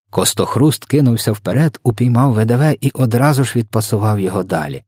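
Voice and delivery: ordinary delivery, nothing marked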